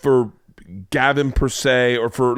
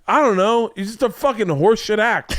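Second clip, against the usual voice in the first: mock angry rant; 9 semitones higher than usual. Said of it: "And he goes".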